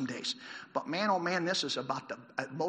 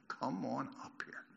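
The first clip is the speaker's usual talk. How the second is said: slow, low, much softer quote; retold yell, hushed; 5 semitones lower, 12 dB quieter, slower than usual. Preacher recalling the soldiers' four words, slow and hushed. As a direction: repeat the soldiers' yell slowly and quietly. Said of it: "The soldiers yelled four words".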